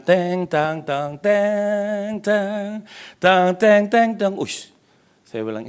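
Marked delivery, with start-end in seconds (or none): 0.1-4.4 sing-song "teng tang" like piano keys, pitched high; imitated piano notes. Preacher imitates the hotel piano for the congregation, high ringing notes. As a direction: mimic a grand piano melody with your voice. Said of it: "ada grand piano dimainkan".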